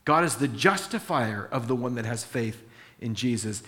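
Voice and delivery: normal talking voice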